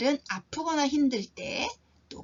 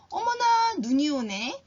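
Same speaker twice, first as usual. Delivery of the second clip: delighted surprise; 6 semitones higher, 4 dB louder than usual. Shown as a demonstration. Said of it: "이렇게 말해도 됩니다".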